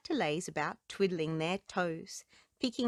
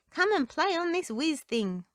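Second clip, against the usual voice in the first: excited call; 8 semitones higher, 4 dB louder than usual. Acted. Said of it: "Boogaloo cried".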